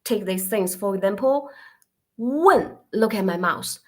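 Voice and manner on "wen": WEN rising high, then falling sharply; falling-tone WEN, as a teacher models it; demonstrated Chinese tone. Shown as a demonstration. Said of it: "If you pronounce".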